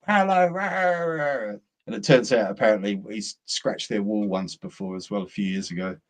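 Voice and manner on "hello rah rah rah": mock ranting neighbour, pitched high; shouting neighbour. Neighbour's wife shouting about the fence, hammed-up rant. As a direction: send up a neighbour's angry tirade. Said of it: "Francisco's wife's come out shouting".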